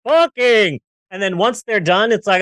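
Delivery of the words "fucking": slow, high, shouted grand proclamation; mock film character. Zion leader announcing the orgy, slow hammed-up shout. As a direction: ham up an epic rallying speech.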